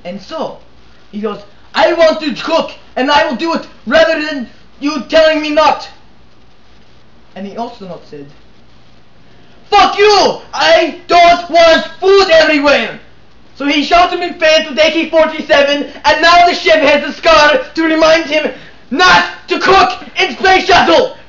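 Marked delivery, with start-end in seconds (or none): 1.8-5.9 indignant shout. Acted. Chef argues with astronaut. 9.7-13.0 higher, louder, slow angry yell; furious shout. Acted. Astronaut yells at chef. 13.5-21.2 shouted punchline. Acted.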